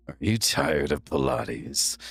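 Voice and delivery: Optimus Prime infomercial voice. Shown as a demonstration. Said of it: "Optimus Prime ... doing three A M infomercials".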